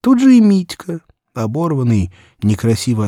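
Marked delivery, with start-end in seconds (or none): none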